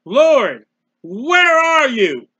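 loud, drawn-out cry to God. Acted. Man with wife in hospital calling on God, loud drawn-out cry. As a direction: call out slowly at full voice, stretching the words.

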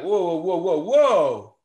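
high, loud string of whoas, falling at the end; mock startled whoa. Presenter mimicking the audience's shocked pushback, mock alarm. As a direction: pull back as if the idea shocks you. Mock reaction to a provocative claim.

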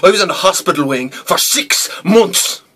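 high and loud, punching the last words; loud outburst. Acted. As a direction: burst out loudly on the length of it. Bitter climax of prison beating story.

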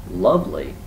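acted scornful repeat; disbelieving echo. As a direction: throw the word back in disbelief. Rabbit echoes Bear in disbelief.

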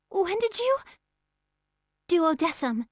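ordinary talk

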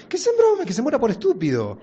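high loud cackling rooster voice; mocking rooster. Rooster mocks the doomed master, high and scornful. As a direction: crow it with scorn and a laugh.

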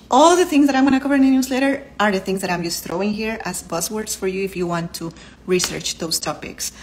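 normal talking voice

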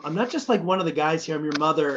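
usual speaking voice

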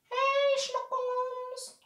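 very high, soft pet-talk voice; cooing to a cat. Real, not acted. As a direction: coo a tiny high greeting to the cat. Greeting the cat.

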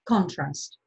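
"contrast" with the stress on CON; noun stress on contrast. Shown as a demonstration. Teacher gives the noun for αντίθεση, "contrast" stressed on CON. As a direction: say "contrast" as CONtrast, first syllable stressed. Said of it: "όπως εδώ καλή ώρα".

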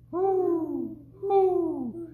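high owl hoot. Pretend. Parent reading the owl page, high hoots. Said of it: "He can sound like an owl".

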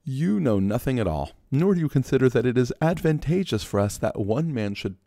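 high-voiced Caiaphas. Caiaphas scolding the council.

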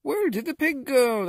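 loud, very high bewildered cry; animals crying out. A narrator's reading. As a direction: cry out in high-pitched dismay. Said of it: "the animals cried".

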